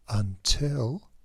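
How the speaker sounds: ordinary delivery, nothing marked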